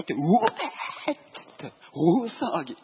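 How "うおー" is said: put-on loud rowdy drunken howl; drunken roar. Drunk salarymen raising a racket in the taxi, loud howl.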